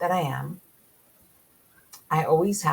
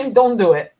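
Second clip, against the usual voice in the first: "Don't" hit hard and loud; emphatic louder command; 4 semitones higher, 11 dB louder than usual. Acted. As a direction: bark "Don't do it" as a firm warning. Urging viewers to avoid news.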